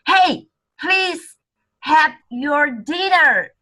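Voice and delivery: high, loud playful dad voice; daddy voice. Shown as a demonstration. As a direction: play the daddy reading it. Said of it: "use this voice to read this sentence".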